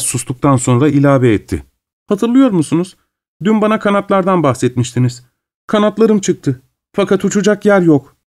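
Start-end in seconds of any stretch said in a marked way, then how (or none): 2.1-8.2 put-on higher, dreamy young woman's voice; a girl confiding a sad longing; lightly voiced dreamy girl; wistful young girl. A narrator's reading. Asya's voice in narration.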